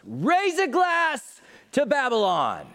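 high, loud, drawn-out toast; hammed-up bar toast. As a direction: lift a glass and proclaim it.

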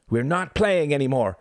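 high, forceful shout. Acted. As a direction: shout to halt the game. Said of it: "he shouted".

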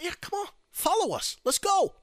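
high, loud hurrying order; impatient summons. Acted. Secret Service leading the president away, high loud summons. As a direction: call him along briskly.

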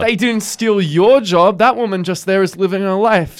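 high, drawn-out protest; hammed-up moral defender; mock righteous rebuttal. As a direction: argue back indignantly. Arguing back in retold quarrel.

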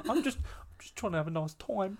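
high-pitched mock exasperation with laughs; flustered protest. Busy worker fending off a chatty colleague, mock exasperated. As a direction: stammer out a hammed-up protest. Acting out own flustered reply.

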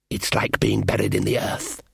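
low, hissing elf voice. Acted. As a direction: hiss it out like a cold elf. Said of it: "hissed the elf".

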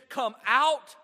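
loud, high order, rising on the last word; shouted command. Acted. Jesus casting the spirit out, shouted command. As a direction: order it out at full force.

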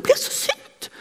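high, airy mock-angry repeat; young nurse repeating her anger to a friend; imitating angry young woman. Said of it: "sa hun".

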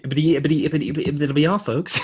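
lightly voiced Porky Pig stutter; a hint of Porky Pig signing off. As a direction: sputter "ibbity, ibbity" like the stuttering cartoon pig. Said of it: "like, a Porky Pig".

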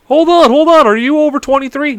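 loud, high call to stop; insistent hawker. Retold or imitated. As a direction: imitate a street seller cutting someone off. Imitating a hawker stopping him.

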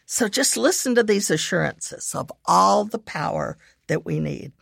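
ordinary talk, nothing marked

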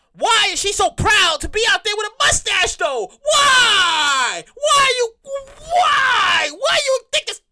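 high incredulous shout; exasperated yelling. Acted. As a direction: demand an answer, outraged. Exasperated rant at mustache.

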